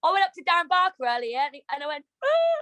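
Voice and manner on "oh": a high sobbing oh; a high mock sob; retold crying. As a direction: break into a teary wail. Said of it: "I started crying".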